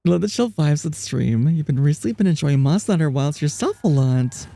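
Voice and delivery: ordinary talk, nothing marked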